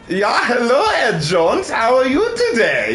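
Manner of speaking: high, forceful mock-German greeting; aggressive German accent. Shown as a demonstration. Demonstrating the other German accent, aggressive German. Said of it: "the kind that sound incredibly aggressive".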